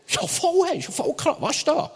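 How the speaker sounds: high, excited youth voice, startled by the heat; amazed friend. Retold or imitated. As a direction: play the stunned friend, pitch way up.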